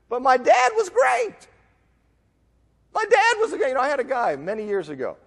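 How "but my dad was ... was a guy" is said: put-on high-pitched whiny-child style protest; acted indignant plea, pitched high; mock protesting excuse. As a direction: plead it way up high, mocking the excuse. Sinner's excuse to God, mocked.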